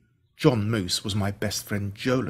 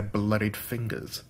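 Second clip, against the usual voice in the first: usual speaking voice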